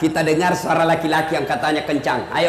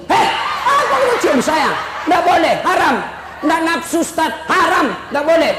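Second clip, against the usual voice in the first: mock alarmed refusal; 9 semitones higher than usual. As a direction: shriek it out in fake panic, laughing. Ustadz refusing a kiss, mock panic. (teriakan panik pura-pura sambil tertawa)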